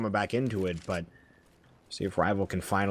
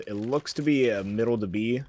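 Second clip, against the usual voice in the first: ordinary talk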